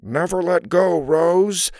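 put-on male role, out of the mouth's corner; hammed-up movie hero's plea; side-of-mouth man's voice. A narrator's reading. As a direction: talk from the side of your mouth. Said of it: "in a lower voice out of the side of her mouth".

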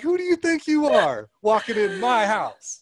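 put-on high, loud bossy little kid voice; bossy toddler. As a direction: demand it like a tiny boss. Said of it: "Like, boss".

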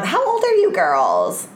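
high, slow, tipsy question; drunk woman voice. Retold or imitated. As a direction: ask it like a nosy drunk.

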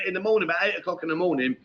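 normal talking voice

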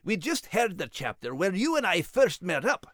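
a proud little Scottish terrier; gruff Scottish brogue, defensive; Scottish dog Max. Acted. Max the dog protests his bravery in a Scottish accent. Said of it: "Max Scottish dog character".

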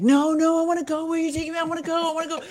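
put-on childlike longing; eager pleading. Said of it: "And I was like".

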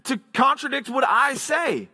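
high-pitched affronted retort, acted out; mock indignation, voice jumping up; indignant challenge. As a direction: imitate a slighted expert challenging an upstart. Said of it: "he's thinking to himself".

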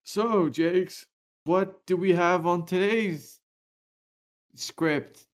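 halting stand-in co-host impression; Elijah impression. Host covering alone for his co-host, halting impersonation. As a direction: pretend to be the missing co-host, haltingly. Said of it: "pretend to be Elijah".

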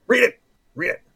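loud, high eager urging; excited. Real, not acted. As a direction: push them to read it now. Urging co-host to read question.

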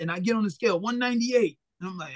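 ordinary talk

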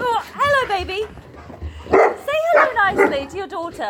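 high, sing-song voice for a puppy. Real, not acted. Asking the dog to greet its daughter, high cooing voice.

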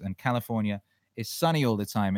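ordinary talk, nothing marked

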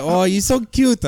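high, loud, slow cooing grandmother; imitating an adoring abuela; doting abuela. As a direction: gush over a grandchild like grandma. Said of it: "She'd be like".

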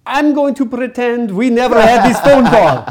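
loud, high-pitched imitation of an Italian agent; thick Italian accent. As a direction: belt it out in a mock Italian accent. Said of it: "she has this really thick Italian accent".

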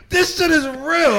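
high, slow, laughing through the words; laughing insistence. Real, not acted. Host defending his made-up fact, laughing and high. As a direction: insist it's real while cracking up.

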